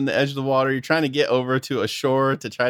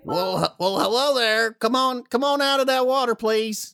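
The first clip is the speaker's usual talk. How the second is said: high, drawling Southern guard voice; 9 semitones higher than usual. Acted. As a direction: coax them out like a folksy Southern sentry. Guard hails party in water.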